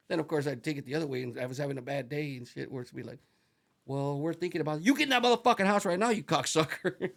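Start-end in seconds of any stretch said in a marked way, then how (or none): 3.8-7.1 raised, slowed mock yell at a worker; retold yelling. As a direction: bark the order like an angry boss. Boss yelling him into the house.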